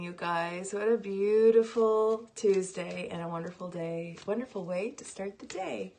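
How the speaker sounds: usual speaking voice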